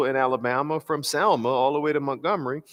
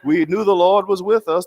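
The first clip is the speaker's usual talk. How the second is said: much higher and louder, emphatic; John Lewis impression, louder and higher; 7 semitones higher, 8 dB louder than usual. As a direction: repeat the line with full preacher force. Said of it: "my attempt to do his voice".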